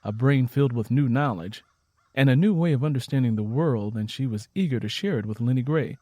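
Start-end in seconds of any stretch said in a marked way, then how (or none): none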